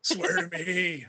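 slow, growled, laughing superhero voice; Batman voice. Pretend. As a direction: rasp it slowly like a dark hero. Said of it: "Batman voice".

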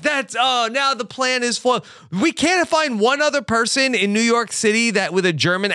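high-pitched retold protest, lightly frantic; mock exasperation. Retells Lewis's frustrated reaction to refusal.

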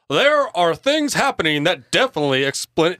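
loud, booming senator voice; pompous senator oratory. Acted. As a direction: orate it like a politician. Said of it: "what's a good senator voice?".

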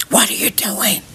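soft unvoiced whisper; hushed whisper to a pestering cat. Shown as a demonstration. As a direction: breathe it at the cat, no voice. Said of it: "I whisper like".

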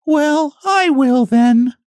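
tired but determined, take it on again; discouraged hen. Acted. Hen giving up on the animals, high slow discouraged voice. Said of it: "rather discouraged".

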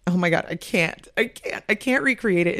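usual speaking voice

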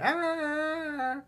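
put-on high, startled "ah" from a panicking girlfriend; a sudden high yelp of alarm; freaked-out gasp. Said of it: "She's freaking out about it".

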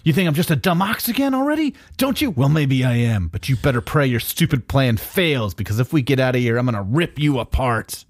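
high, hammy dumb-brute voice; dopey cartoon bellow. Hulk replying to Reed's insult.

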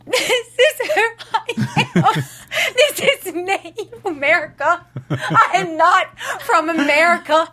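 high, slow, hammed-up island accent; mock Virgin Islands accent. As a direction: mimic a contestant's Caribbean accent, drawn out through laughter. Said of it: "she sounded like she was from Miami at first".

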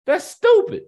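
scornful outburst. Real, not acted.

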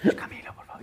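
breathy whisper, half-joking plea. Retold or imitated. Singer pleads with his wife to celebrate, whispered. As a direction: beg in a whisper without waking the baby.

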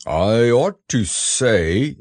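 very slow, growly bear; ponderous bear. A narrator's reading. Pooh begins explaining his boat, ponderous bear. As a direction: announce it slowly as the bear.

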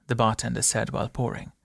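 plain reading voice

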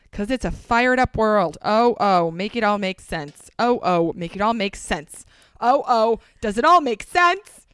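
dramatic lyric reading swelling to a shout; building to a belt. Pretend. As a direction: build it up to a big dramatic finish. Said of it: "interpretive read".

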